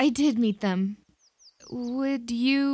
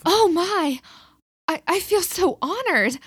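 flustered surprise; 6 semitones higher, 4 dB louder than usual. Acted. Delilah surprised by trust.

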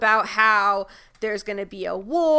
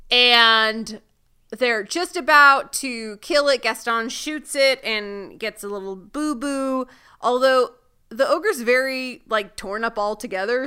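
ordinary delivery

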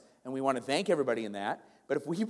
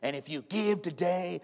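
hammed-up hype preacher voice, a bit higher; mocking prosperity preacher; 3 semitones higher than usual. Mocking a prosperity preacher to the congregation, hyped-up preacher voice. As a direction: parody a money-promising preacher. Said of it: "I'm making fun of that".

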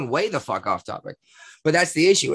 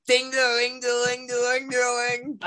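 alarm bell imitation; 8 semitones higher than usual. Imitating fire alarm bells.

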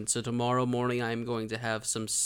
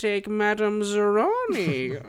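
put-on slow, higher old fortune-teller voice; Madame Zeroni voice; 8 semitones higher, 5 dB louder, slower than usual. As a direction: intone it like an old curse. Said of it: "You gave me Madame Zeroni from Holes".

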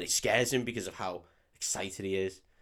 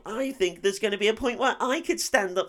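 high, squeaky-pitched mock wimp voice; hammed-up high voice, deluded and pleading for applause; mocking little runner; 14 semitones higher than usual. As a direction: send up a little runner's self-important daydream. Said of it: "When he was a little, little runner and he thought".

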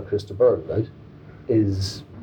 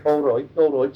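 higher-pitched Brummie warm-up; put-on Brummie, pitched up; Birmingham accent; 6 semitones higher than usual. Shown as a demonstration. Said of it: "I have to tune in my Birmingham accent".

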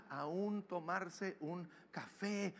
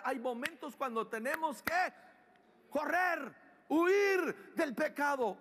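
full-force preaching; 9 semitones higher, 7 dB louder than usual. Real, not acted. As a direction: urge them to flee, shouting ever higher.

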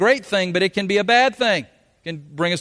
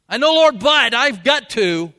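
louder, slow whiny insistence; mock protest; 6 semitones higher, 7 dB louder, slower than usual. Preacher protesting to the Lord, loud and pleading. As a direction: plead your case stubbornly.

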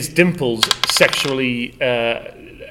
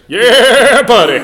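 loud, high whooping catchphrase; yell; 9 semitones higher, 12 dB louder, slower than usual. Pretend. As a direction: holler it out to demonstrate. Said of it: "yell, "Yeah, buddy," until your next turn".

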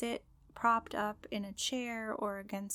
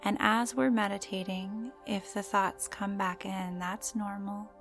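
guided meditation; slower than usual. Acted. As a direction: gently guide the listener into calm.

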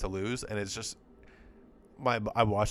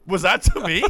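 higher and louder, stunned disbelief; incredulous Gesicki impression; 8 semitones higher, 9 dB louder than usual. Co-host mimics Gesicki looking around, higher and louder in disbelief. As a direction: play the receiver baffled the ball was meant for him. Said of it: "He's looking around like".

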